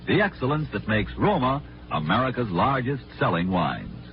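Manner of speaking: ordinary talk, nothing marked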